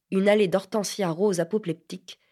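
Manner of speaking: ordinary delivery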